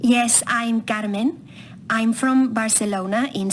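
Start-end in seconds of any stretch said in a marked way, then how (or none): none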